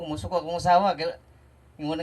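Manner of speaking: ordinary talk, nothing marked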